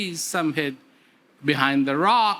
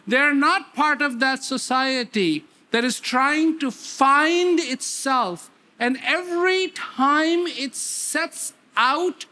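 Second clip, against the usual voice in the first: preaching; 6 semitones higher than usual. Acted. Preacher telling listeners what the story meant to inmates, earnest sermon. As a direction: drive the lesson home to the congregation.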